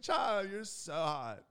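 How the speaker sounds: put-on high, slow swooning girl voice. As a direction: imitate a girl cooing, stretched out. Imitates admiring girls.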